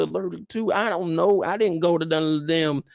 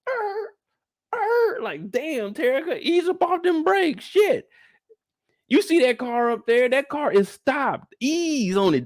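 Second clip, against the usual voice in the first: high-pitched, frantic coaching of a bad driver; panicked passenger; 6 semitones higher than usual. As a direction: squeal at her to ease off the brakes, high and tense. Coaching Terica's braking from the passenger seat.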